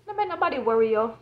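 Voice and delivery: ordinary talk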